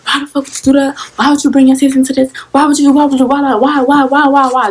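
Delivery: high, heated rapid-fire scolding; cussing out. Retold or imitated. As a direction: imitate a mother furiously demanding answers. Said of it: "just cussing me out".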